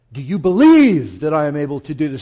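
BELIEVE loud and stretched, falling after; stress on BELIEVE. Shown as a demonstration. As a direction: lean all the pressure on "believe". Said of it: "That's how we hear it".

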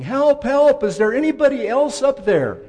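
high, loud mock yell; yelling for help. Hiker rejecting God's answer yelling for anyone else, loud and high. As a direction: bellow it up the mountain. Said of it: "the guy starts yelling".

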